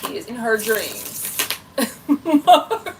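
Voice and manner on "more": laughing. Real, not acted. Laughing at the chat's reaction.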